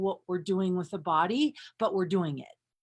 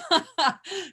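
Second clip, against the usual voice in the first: a higher, breaking giggle; higher giddy laughter; 7 semitones higher, 3 dB louder than usual. Real, not acted. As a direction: laugh giddily at your own tease. Laughs after teasing.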